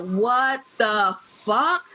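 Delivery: slow, stretched-out disbelief; stunned what the fuck. Retold or imitated. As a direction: drag it out, floored by the news. Retelling shock at the DMV.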